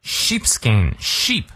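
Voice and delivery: "sheep" slowly stretched on a long ee; long vowel held fully. Shown as a demonstration. Teacher drilling the long vowel, "sheep" held slowly. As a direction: draw out the ee in "Sheepskin". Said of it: "长一念到位".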